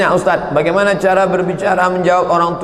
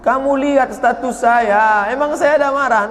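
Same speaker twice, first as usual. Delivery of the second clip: mock innocence; 8 semitones higher, 3 dB louder than usual. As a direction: play innocent, teasing. Ustadz voiced, mock innocence.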